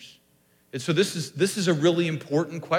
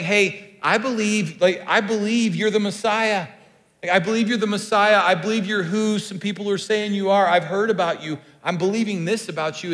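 acted declaration; 5 semitones higher, 6 dB louder than usual. Acts Bartimaeus declaring his belief aloud.

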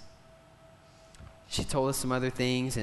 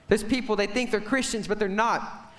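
higher, insistent preacher's delivery; impassioned preaching; 8 semitones higher, 4 dB louder than usual. Real, not acted. Preacher warning of people who think they're Christians, impassioned. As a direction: press the warning on the congregation.

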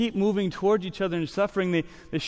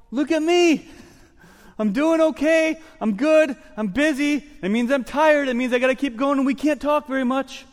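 high, loud, hammed-up act of being fine; hammed-up fake okay; 8 semitones higher, 6 dB louder than usual. Pastor mocks churchgoers pretending they're fine, much higher and louder. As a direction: put on a bright front, overplayed. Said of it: "makes us have to go".

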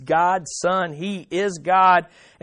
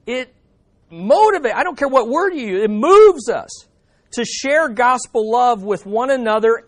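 preach; 5 semitones higher, 5 dB louder than usual. Acted. Rising preaching climax.

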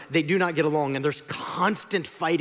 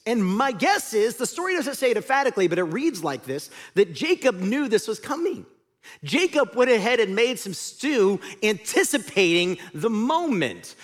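ordinary delivery

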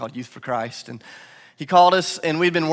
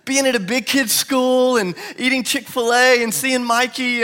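excited son; 9 semitones higher, 5 dB louder than usual. Retold or imitated. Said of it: "And he said".

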